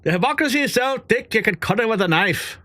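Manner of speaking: put-on joking Indian accent on the news line. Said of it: "I started going Indian".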